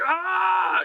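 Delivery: high drawn-out cry; mock collective gasp. Acting out dwarves and creatures reacting, mock cry.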